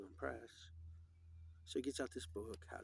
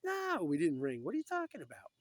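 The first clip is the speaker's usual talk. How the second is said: indignant shout; 6 semitones higher, 10 dB louder than usual. Retold or imitated. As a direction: snap back at the butler, fed up. Old man snaps at butler.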